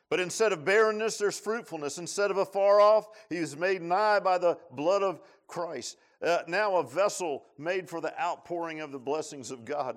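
high, loud preaching climax; full-force preaching. Acted. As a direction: proclaim it to the whole church.

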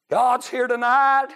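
high, loud, drawn-out preaching cry; loud proclamation. Real, not acted. Preacher proclaiming the old saint's words to church, loud cry. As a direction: proclaim it slowly at full voice. Preacher repeats quote at full voice.

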